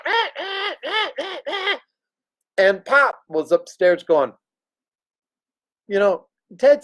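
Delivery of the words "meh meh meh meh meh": high, nasal-free squawking meh, meh like a crow; crow caw imitation. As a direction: caw it out way up high like a bird in the basement. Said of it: "the basement that was going".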